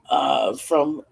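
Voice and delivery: usual speaking voice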